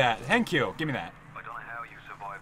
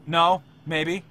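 normal talking voice

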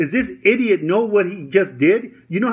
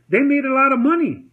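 ordinary talk

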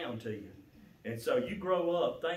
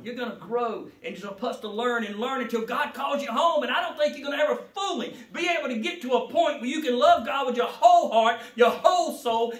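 loud, rising preacher's cry; preaching at full force; 10 semitones higher, 11 dB louder than usual. Acted. Preacher exhorting the congregation, loud and rising. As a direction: preach it out to the church.

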